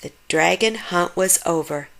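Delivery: plain reading voice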